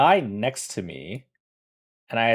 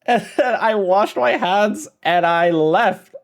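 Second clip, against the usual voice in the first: high, loud laughing delivery; 8 semitones higher, 5 dB louder than usual. Real, not acted. Storyteller recounting a hasty bathroom exit, laughing. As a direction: tell it through laughter, drawn out and higher.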